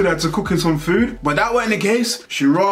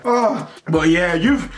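usual speaking voice

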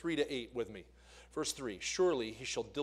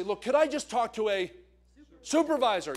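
demanding a supervisor; 6 semitones higher, 6 dB louder than usual. Retold or imitated.

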